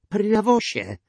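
high, loud desperate cry; sharp, high shout of a name; called out. A narrator's reading. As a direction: cry out your son's name in despair. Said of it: "zawołał".

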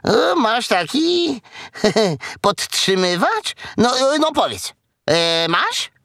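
high voice with little giggles; giggly, nosy piglet. Acted. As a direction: pester with halting, giggly questions. Piglet keeps asking, giggling.

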